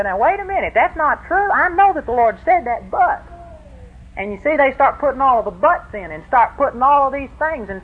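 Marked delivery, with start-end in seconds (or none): 0.0-3.2 higher, whiny doubting voice; mocking objection. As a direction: mimic a fussy objector, pitched up. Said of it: "religions come along and say".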